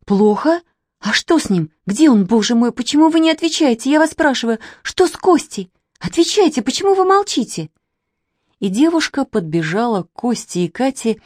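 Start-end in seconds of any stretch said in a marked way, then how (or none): 0.0-7.7 high, frantic string of questions; agitated questioning. A narrator's reading. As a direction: demand answers, climbing higher and faster. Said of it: "взволнованно трясти их за плечи".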